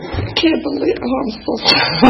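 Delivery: tearful, high and soft, voice cracking; breaking down while sharing her story; real crying. Real, not acted. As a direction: apologize through tears. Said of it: "now I'm crying".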